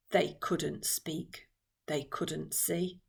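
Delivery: slow, low and soft; hushed. A narrator's reading. Narrator tells of travellers trapped under water, hushed. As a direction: drop to a slow hush, word by word.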